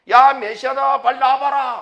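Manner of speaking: loud, high-pitched announcing call; watchman's shout. Acted. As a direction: shout to bring everyone out. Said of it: "그거 소리치잖아요". (파수꾼처럼 높고 크게 외치기)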